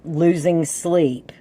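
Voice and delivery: normal talking voice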